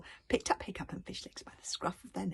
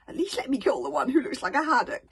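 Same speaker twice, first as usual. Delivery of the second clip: pleading; 7 semitones higher, 10 dB louder than usual. A narrator's reading. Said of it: "pleaded Alvin the treacherous".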